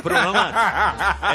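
laughing. Pretend. Pun on pruno, laughing.

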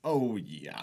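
usual speaking voice

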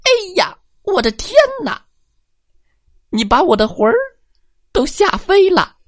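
high, fast squeaky granny shriek; an old woman scared out of her wits; tiny old lady crying out in fright; frightened old granny. Acted. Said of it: "捂着脸".

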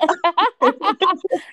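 big high laugh at herself; loud laugh. Real, not acted. Guest laughing after forgetting her podcast, loud and bright. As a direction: laugh out loud, a little embarrassed.

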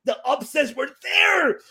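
high exclamation of frustration; exasperated protest. Real, not acted. Host lamenting the booking, high exasperated cry.

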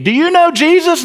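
put-on high, loud childlike question; eager child. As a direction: squeak it out like an excited little kid. Child asking dentist about Jesus.

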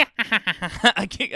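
high cackling laugh; witch cackle. Pretend. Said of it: "I was trying to do a witch cackle".

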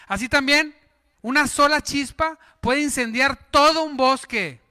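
solemn preacher's reading; scripture reading. Acted. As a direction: read the verse with weight. Reads scripture.

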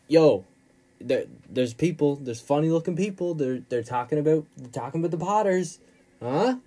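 loud, stumbling, worked-up phone call; mock alarm. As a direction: blurt it out like a hammed-up panicky caller. Acted alarmed phone call to Petunia.

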